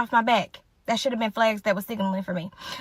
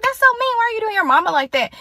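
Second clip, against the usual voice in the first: high-pitched, louder scandalized objection; indignant protest; 10 semitones higher, 4 dB louder than usual. Retold or imitated. Scolding friend over her mama, high indignant protest. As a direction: protest it high, imitating your shocked self.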